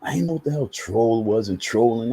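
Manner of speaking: ordinary talk, nothing marked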